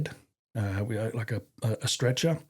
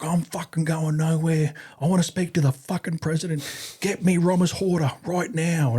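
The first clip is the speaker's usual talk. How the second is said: higher-pitched, imitating a defiant prisoner; defiant demand; 7 semitones higher than usual. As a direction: mimic him refusing to move. He's like.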